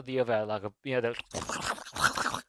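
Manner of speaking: wet crunching mouth noise; imitated creature chomping; munching and crunching. Film fan demonstrating the gore he wanted, munching noises. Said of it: "munching and crunching sort of sounds".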